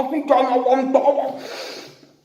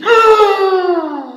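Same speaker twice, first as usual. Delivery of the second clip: grito agudo y fuerte; grito ofendido de monstruo; 10 semitones higher, 7 dB louder than usual. Acted. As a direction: chillido de indignación. (monstruo ofendido grita agudo)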